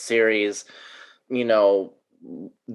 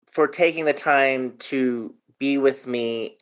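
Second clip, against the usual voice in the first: ordinary talk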